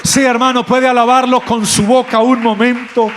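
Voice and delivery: rally the whole church, climbing each time; preaching at full force. Real, not acted. Pastor urges every listener not to be afraid, preaching at full force.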